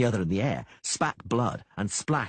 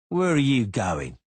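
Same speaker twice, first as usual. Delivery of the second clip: slow, higher, louder challenge; Cicada challenging; 4 semitones higher, 6 dB louder, slower than usual. A narrator's reading. Cicada stopping the Witcher, slow and louder. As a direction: block his path with the question.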